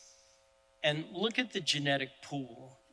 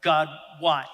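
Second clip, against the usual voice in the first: loud, raw demand of why; angry cry to God; 2 semitones higher, 9 dB louder than usual. Retold or imitated. A father mad at God, loud angry cry. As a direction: shout it up at the sky in anger. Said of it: "I'm mad".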